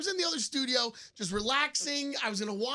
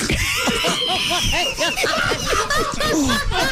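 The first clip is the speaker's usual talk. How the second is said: high, loud laughing yell; laugh-scream from the burn; pained laughing shout; 11 dB louder than usual. Real, not acted. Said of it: "my mouth is on fire".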